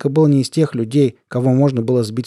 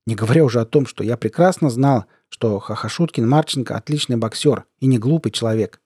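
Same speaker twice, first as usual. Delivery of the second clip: ordinary delivery, nothing marked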